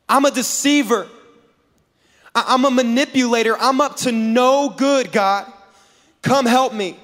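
high-pitched, insistent admission and plea; emphatic confession. Acted. Jacob confessing to God and begging help, emphatic. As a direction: own up to God with urgency.

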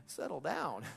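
exasperated calming reproof, starting high; mock-weary telling-off; Jesus. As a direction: rein in an overexcited friend. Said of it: "And Jesus is like".

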